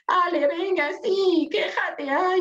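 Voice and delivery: sarcastic self-mockery; mock teasing. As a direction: tease yourself for complaining. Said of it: "y digo".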